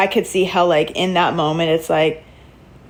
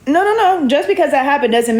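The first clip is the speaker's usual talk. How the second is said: emphatic objection; 7 semitones higher than usual. Real, not acted. Vlogger objecting to reality show.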